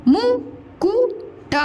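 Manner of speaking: Mukut drawn out high. Shown as a demonstration. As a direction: stretch Mukut for the class. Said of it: "teacher models word slowly".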